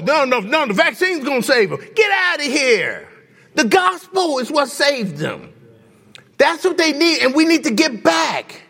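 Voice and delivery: high, driving pulpit voice; fervent sermon delivery; preaching at full force. Acted. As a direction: build the appeal to the congregation. Preaching climax.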